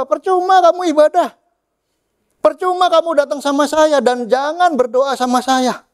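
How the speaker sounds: very high, strained-up indignation; acted high-pitched reproach; exasperated rebuke. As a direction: scold them as a fed-up God. Voices fed-up God rebuking worshippers.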